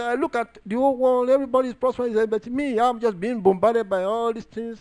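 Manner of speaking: high, pleading lament; complaining David. Retold or imitated. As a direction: cry out the complaint high-pitched. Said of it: "David said".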